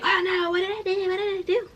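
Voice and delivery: high-pitched imitation of a flustered manager; mock panic. Manager reacting to her tears, mock panic. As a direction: fret in a squeaky, put-on voice.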